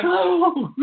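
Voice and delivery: high, hammed-up swooning "oh"; mock fainting gasp. Host mocks Clinton about to faint dead away, swooning. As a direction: fake a faint, breaking into a laugh. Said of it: "she was gonna faint dead away".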